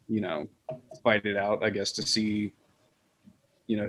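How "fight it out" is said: ordinary talk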